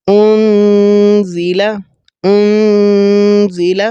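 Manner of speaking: انزل very slow, the نون hidden in the nose; ikhfa recitation. Shown as a demonstration. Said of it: "ناک کے اندر آپ نے آواز کو چھپانا ہوتا ہے". (انزل میں اخفا، ناک سے)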